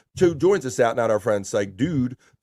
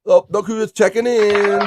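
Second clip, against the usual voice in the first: excited; 9 semitones higher, 6 dB louder than usual. Acted. Reading chat, excited greeting.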